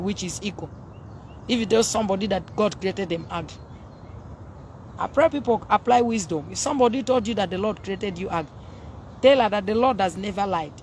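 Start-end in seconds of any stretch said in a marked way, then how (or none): none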